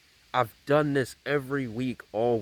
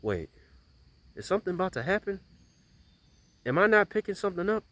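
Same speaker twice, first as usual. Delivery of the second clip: anxious inner voice; 9 semitones higher, faster than usual. Retold or imitated. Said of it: "I'm thinking to myself, I'm like".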